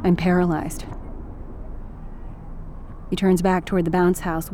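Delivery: ordinary delivery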